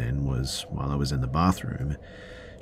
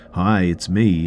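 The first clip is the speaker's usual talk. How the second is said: bright, higher sing-song greeting; 4 semitones higher, 6 dB louder, slower than usual. A narrator's reading. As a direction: lilt it like an old friend. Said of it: "in a sort of sing-song voice".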